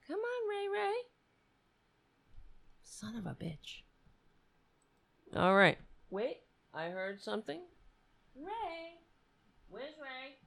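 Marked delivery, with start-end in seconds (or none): 0.1-1.0 much higher, soft sing-song coaxing; softly cooing to the cat; high coaxing pet voice. Real, not acted. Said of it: "I'm trying to change the tone of my voice". 8.4-10.4 high, soft calling voice; softly calling for the cat Ray. Real, not acted. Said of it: "I'm trying to change the tone of my voice".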